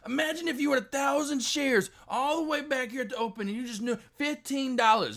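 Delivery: high, loud excited imitation with a laugh; mimicking Deck. Streamer mimics friend Deck dreaming of gains, high and excited. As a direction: crank up a gleeful mock Deck. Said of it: "You know, or E D T X".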